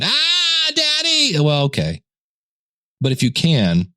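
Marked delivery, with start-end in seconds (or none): 0.0-1.4 put-on much higher small-child wail; be a sleepy kid waking dad at four; high kid's whine calling for daddy; whiny little kid. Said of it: "kids that get me up at four in the morning going".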